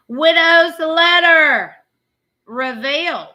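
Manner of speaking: slow, loud headline shout; announcer shout. Acted. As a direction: announce it like breaking news. Reading headline aloud.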